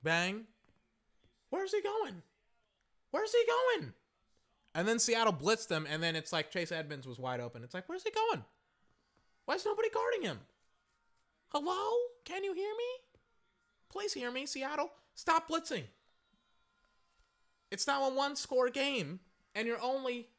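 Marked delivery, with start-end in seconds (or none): 1.5-2.2 very high, squeaky disbelief; incredulous. Acted. Fan questions Seattle's blown coverage, incredulous. 3.1-3.9 very high, louder squeal of disbelief; incredulous outcry. Acted. Fan asks once more about the open receiver, crying out incredulously. Repeats the question, more worked up. 7.9-15.8 very high, squeaky disbelief; exasperated pleading. Acted. Fan begs Seattle's defence to stop blitzing, exasperated.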